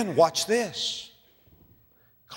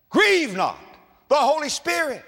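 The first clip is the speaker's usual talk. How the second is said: ordinary delivery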